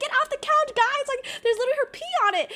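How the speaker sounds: high-pitched, loud imitation of grossed-out friends; mock alarm. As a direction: shriek a hammed-up warning. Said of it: "They'll be like".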